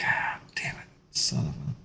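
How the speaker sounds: hushed frustrated swearing under the breath; whispered curse. Real, not acted. Streamer swearing at himself in a whisper.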